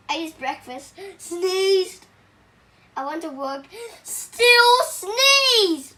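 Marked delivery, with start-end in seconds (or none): 1.2-2.1 sneezed said loud like a sneeze; loud mock sneeze. Story sneezes acted out. 4.0-5.9 high, loud, sneeze-like burst; loud high sneezed. Acted. Story sneezes acted out.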